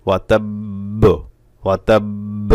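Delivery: ওয়াতাব্ব with a long held b, then a pop; strong qalqalah. Shown as a demonstration. Teacher gives an example for students, strong echoing bounce. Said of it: "একটু বিকট আওয়াজেই".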